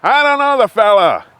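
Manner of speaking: put-on high, loud old man's voice; half-deaf old neighbor answering loudly; old man's reply. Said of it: "the other guy's".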